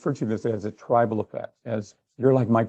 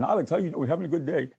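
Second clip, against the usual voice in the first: higher-pitched, hammed-up accent impression; bad Arnold; 5 semitones higher, faster than usual. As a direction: do a badly faked action-star accent. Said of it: "like a bad Arnold impression".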